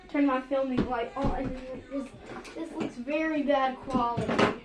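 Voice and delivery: normal talking voice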